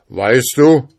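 terrible voice. A narrator's reading. Freed spirit confronts the schoolboy, terrible loud voice. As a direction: call out as a fearsome giant spirit. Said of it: "rief er mit einer fürchterlichen Stimme".

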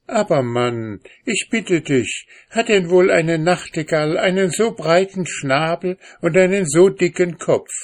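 sehr hohe Frauenstimme, ungläubig; kopfschüttelnd nachfragen; ungläubige Ehefrau. A narrator's reading. Frauenstimme gespielt. (Ehefrau hält dem Mann ungläubig entgegen)

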